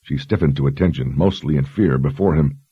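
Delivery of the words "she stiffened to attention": usual speaking voice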